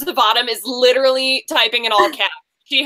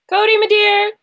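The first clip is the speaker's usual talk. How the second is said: high, louder sing-song call to a frozen guest; 8 semitones higher, 5 dB louder than usual. Real, not acted. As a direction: call her back fondly across the line.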